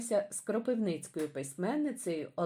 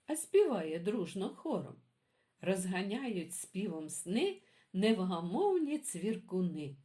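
put-on lively sing-song poem reading for kids; reading poems to children; 3 semitones higher than usual. A narrator's reading. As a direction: read it like a bedtime rhyme for little ones. Reads children's poems aloud.

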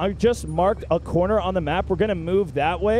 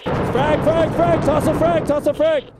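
urgent callout; 6 semitones higher, 4 dB louder, slower than usual. Real, not acted.

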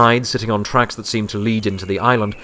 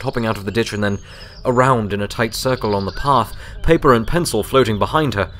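ordinary delivery, nothing marked

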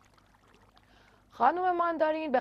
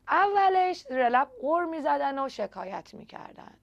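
usual speaking voice

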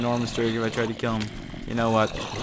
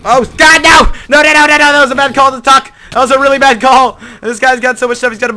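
high, loud panicked yelling; panicked screaming; 11 semitones higher, 13 dB louder than usual. Real, not acted. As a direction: scream in real panic as you're attacked. Attacked by another player.

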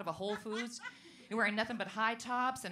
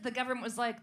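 usual speaking voice

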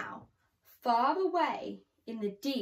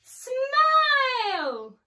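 much higher, much louder joyful cry; triumphant climax; 13 semitones higher, 11 dB louder than usual. A narrator's reading. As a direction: burst out with the big reveal. Story climax, the big smile.

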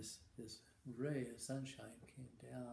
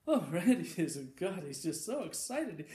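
laughing; 5 semitones higher, 11 dB louder than usual. Real, not acted. Teller laughs at own joke.

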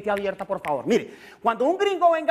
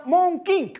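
Monterrey as fake English; hammed-up gringo accent on "Monking"; mock English; 7 semitones higher than usual. Shown as a demonstration. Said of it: "entonces diga". (acento gringo exagerado)

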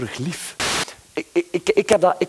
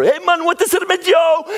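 high, loud mock rapper call; 13 semitones higher, 4 dB louder than usual. Comedian imitating a rapper hailing him, loud and high. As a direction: shout it like a street rapper. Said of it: "Zo'n rapper".

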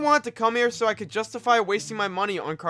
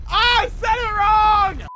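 high, loud, drawn-out wail; dismayed outcry; 10 semitones higher, 5 dB louder, slower than usual. Real, not acted. Player realizing the word was said wrong, loud dismay. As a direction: cry out slowly at your own mistake. Exclaims on discovering own mistake.

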